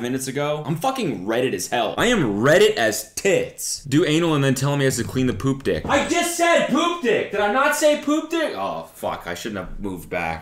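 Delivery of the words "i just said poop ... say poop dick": high, worked-up exclamation; fired-up shouting. Real, not acted. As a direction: shout it in giddy disbelief. Fired up over his joke.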